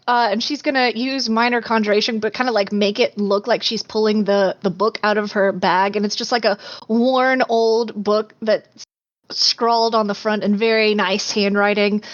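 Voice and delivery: ordinary delivery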